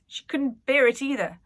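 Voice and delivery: ordinary delivery, nothing marked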